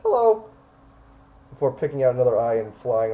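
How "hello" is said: high, loud crow voice; talking crow. Acted. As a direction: greet like a cartoonish crow. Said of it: "a familiar looking crow".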